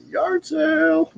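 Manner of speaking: high, slow goofy stoner drawl; slow, dopey and way up high. Pretend. Goofy voice after yard sale joke.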